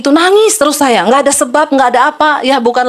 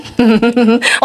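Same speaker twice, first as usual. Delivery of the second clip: real chuckle. Real, not acted. Preacher jokes about important people's numbers, real chuckle. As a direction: chuckle before the punchline.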